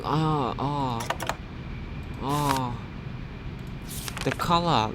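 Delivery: ordinary talk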